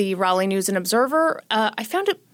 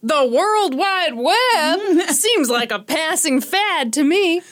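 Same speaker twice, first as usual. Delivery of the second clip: smug nineties voice; 6 semitones higher, slower than usual. Retold or imitated.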